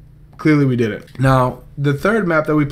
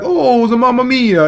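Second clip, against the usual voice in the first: high, hammed-up Italian accent, much higher pitch; mock the Italian players with a singsong Italian accent; 11 semitones higher, 5 dB louder than usual. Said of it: "the Italians".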